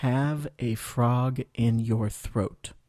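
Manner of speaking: slow, careful second model; slow repeat. Shown as a demonstration. Teacher repeats the phrase slowly for learners. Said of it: "écoutez et répétez".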